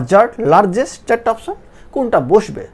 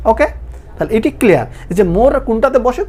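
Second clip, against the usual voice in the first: ordinary talk, nothing marked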